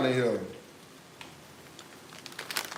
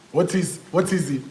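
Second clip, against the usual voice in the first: ordinary talk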